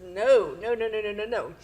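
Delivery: anguished mock wail of no; mild retold sobbing. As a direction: echo of a grieving cry. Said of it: "sobbed and screamed".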